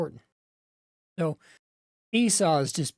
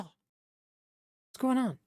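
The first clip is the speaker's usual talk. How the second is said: bewildered plea; 7 semitones higher, much slower than usual. Acted. Esau bewildered by father.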